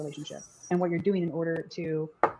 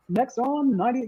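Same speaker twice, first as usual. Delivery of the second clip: higher, louder radio announcer; 5 semitones higher, 5 dB louder, slower than usual. Shown as a demonstration. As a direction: hype it like a DJ intro. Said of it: "Can you do your best radio, like, D J voice?".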